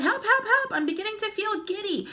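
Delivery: very high, loud cries of help; panicked screaming. Acted. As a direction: wail in a panic. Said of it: "screamed Missus Twit".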